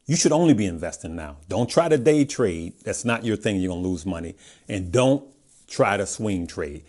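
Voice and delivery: normal talking voice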